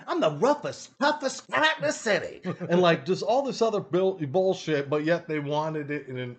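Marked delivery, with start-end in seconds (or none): 0.1-2.4 high put-on cartoon voice; hammed-up tough-cat boast; boastful alley cat. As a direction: brag in a higher character voice. Acting his audition cat's boast.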